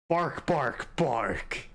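playful imitated barking; mock dog barks. Cat talking to Barkley, mock dog barks. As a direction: bark like a friendly dog.

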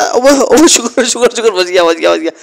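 high, relieved thanks tumbling out; breathless relief. Acted. Just escaped a crash, high relieved gasping. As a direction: gasp out the relief.

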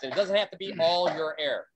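ordinary talk, nothing marked

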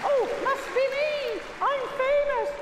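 high, excited put-on voice for a show-off dog; dog's voice. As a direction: pipe up proudly as the dog, high and lifted. Speaking for the dog, answered young lady.

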